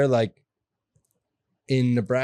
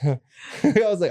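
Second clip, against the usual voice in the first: laughing; 6 semitones higher, much slower than usual. Real, not acted. Laughs at teacher's hand on chest.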